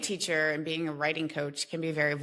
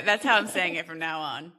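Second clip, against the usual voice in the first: ordinary talk, nothing marked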